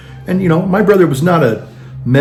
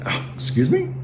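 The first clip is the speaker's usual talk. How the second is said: mock affronted; 12 semitones higher, 9 dB quieter than usual.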